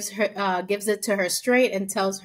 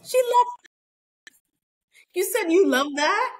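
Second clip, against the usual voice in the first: shocked disbelief; 11 semitones higher than usual. Retold or imitated. Scott shocked that Chris left him, high disbelief. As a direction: act stunned and betrayed, way up high. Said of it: "He's like".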